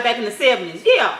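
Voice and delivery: usual speaking voice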